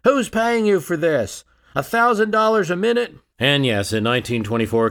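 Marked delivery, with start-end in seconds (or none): 0.0-3.2 heckler's accusation. Retold or imitated. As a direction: accuse him from the crowd. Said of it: "got the hecklers up".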